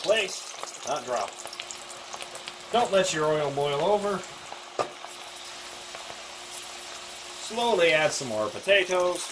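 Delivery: deliberately bad Irish accent; worst Irish accent. Partner cooking chips for the video, in a bad Irish accent. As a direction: ham up a bad Irish brogue. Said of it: "with the worst Irish accent you've ever heard".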